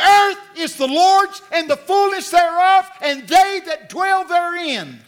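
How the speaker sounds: loud, high, slow proclamation of the verse; preacher commanding the devil with scripture; proclaiming scripture. Retold or imitated. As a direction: declare the verse against the devil. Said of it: "That's the verse I used".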